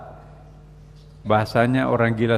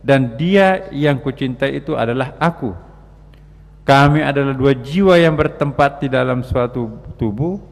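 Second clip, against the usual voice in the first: loud, raised poetic declamation; declaimed mystic verse; 4 dB louder than usual. Acted. Preacher reciting a lover's verse to listeners, loud declamation.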